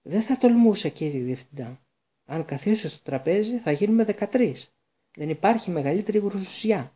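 high, loud, alarmed seer; superstitious alarm. A narrator's reading. Seer refuses the seat, alarmed by thirteen at table. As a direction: recoil from the unlucky thirteen. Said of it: "της ξέφυγε ένα πνιχτό επιφώνημα".

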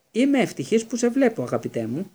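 high, loud, gushing mock formality; mock-pompous. A narrator's reading. Twin mimicking his brother's formal welcome, mock-pompous. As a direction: ham up a lordly greeting, easing down at the end. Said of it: "κάνοντας μια βαθιά υπόκλιση".

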